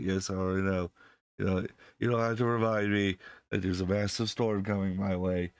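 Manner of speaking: usual speaking voice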